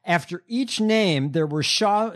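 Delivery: ordinary delivery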